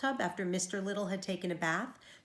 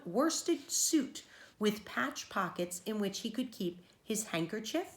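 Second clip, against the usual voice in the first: usual speaking voice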